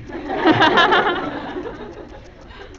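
big high-pitched burst of laughter; loud high laugh. Real, not acted. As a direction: let out a loud squealing laugh. Laughing at the comments.

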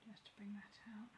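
soft unvoiced whisper. Real, not acted. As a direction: breathe the words under your breath. Quiet face painting aside.

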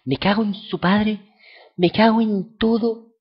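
angry curse. Real, not acted. Furious at stronger rival.

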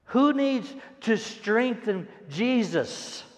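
normal talking voice

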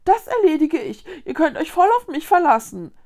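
loud, high, quick parroting; mocking imitation. A narrator's reading. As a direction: ape her boasting to taunt her. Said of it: "äffte Konrad Vicky nach".

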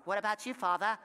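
kid's mock-formal accent on "Father"; lightly hammed-up accent; put-on accent. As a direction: imitate the kid in a mock accent. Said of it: "where you got your accent from".

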